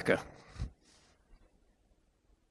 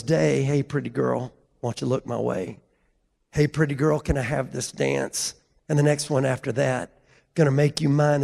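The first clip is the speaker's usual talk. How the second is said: spoken lyric recitation; 5 semitones lower, 4 dB quieter than usual. Retold or imitated. Roofer reciting a country love song to the crowd, low spoken recitation. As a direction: recite it like a poem, unhurried and fading softly.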